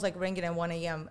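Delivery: ordinary talk, nothing marked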